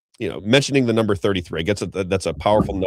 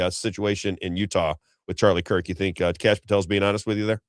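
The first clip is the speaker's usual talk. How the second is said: usual speaking voice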